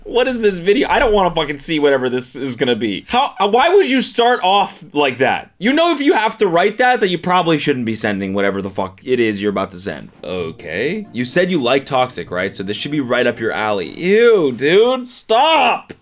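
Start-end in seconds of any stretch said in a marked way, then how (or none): 0.0-7.6 high, exasperated outcry; real stunned disgust; disgusted disbelief. Real, not acted. Reacting in disgust to his text. 13.9-15.9 high, loud squeal of disgust; real disgusted shout; grossed-out yell. Real, not acted. Grossed-out reaction.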